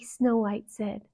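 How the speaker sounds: usual speaking voice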